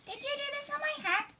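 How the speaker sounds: high squeaky mole voice; a small, put-out mole; little mole. Acted. Mole demanding who dirtied his head, high squeak. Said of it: "he asked the hare".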